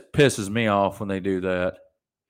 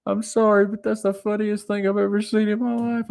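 lightly voiced amused wife, pitched up; wife's voice; 12 semitones higher than usual. Wife laughing at her furious husband, lightly voiced. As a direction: play the wife giggling at him. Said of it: "she's like".

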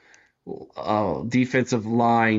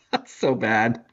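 usual speaking voice

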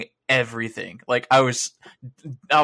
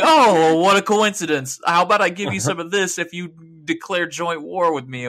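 scheming offer; 5 semitones higher, 4 dB louder than usual. Retold or imitated. AI nation voiced scheming.

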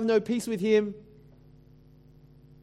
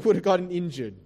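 usual speaking voice

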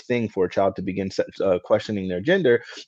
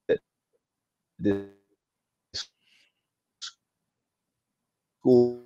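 fading in through static; 3 semitones higher, slower than usual. Real, not acted. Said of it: "You're breaking up there".

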